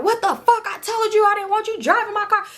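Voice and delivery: high-pitched scolding tirade; mock outraged telling-off, high all through; imitated angry ranting. Mother to daughter who crashed her car, outraged. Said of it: "She just talking shit".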